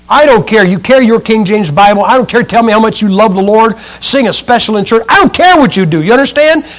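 loud, driving pulpit delivery; fiery preaching. Acted. Preacher warning his flock about vampire books, fiery. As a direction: hammer it home to the congregation.

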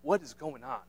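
hushed and measured, stopping him gently; calming wife. Acted. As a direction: quietly settle an over-excited husband. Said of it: "Sarah just stopping him and saying".

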